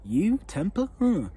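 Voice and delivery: high, loud incredulous questions; disbelieving surprise. Retold or imitated. Friends who knew him as an adult, stunned surprise. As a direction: pitch each word up in shocked disbelief. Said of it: "they're like".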